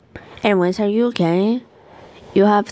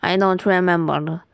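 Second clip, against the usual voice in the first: ordinary delivery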